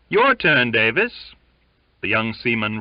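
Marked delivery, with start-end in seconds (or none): 0.1-1.4 calling out. A narrator's reading. Calling next man to wheel.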